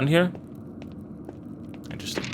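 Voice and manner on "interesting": barely audible whisper to himself; whispered aside. Real, not acted. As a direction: breathe the word out, almost silent. Muttering to himself while exploring.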